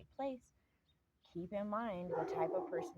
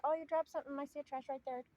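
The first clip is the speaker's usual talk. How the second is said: high, sing-song helpful voice; sweetly polite; 10 semitones higher, 3 dB louder, faster than usual. Retold or imitated. To a litterer, pointing at the trash, high and sugary. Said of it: "Sometimes I'll even say that".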